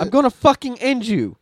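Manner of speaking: high, loud retold threat; mock violent threat. Fed-up user threatens the AI, mock fury. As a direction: blow up at the chatbot in mock fury. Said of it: "Anytime you get violent".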